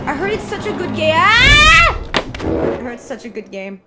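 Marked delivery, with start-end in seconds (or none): 0.1-1.1 higher, eager rush of enthusiasm; gush, building toward a squeal; excited gushing. Real, not acted. Streamer tells chat about a game, excited. 1.1-2.0 high, loud squeal; excited scream. Real, not acted. Streamer thrilled by a game, screaming.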